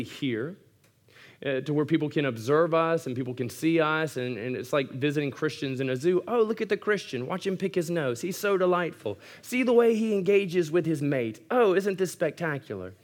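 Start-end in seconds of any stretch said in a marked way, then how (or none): 6.3-12.9 put-on higher British accent, gushing over an exhibit; a British zookeeper delighting in the animals, pitched up; British zoo visitor. Pastor mocks onlookers gawking at Christians, in a higher British accent. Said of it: "they become British".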